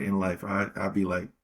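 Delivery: ordinary talk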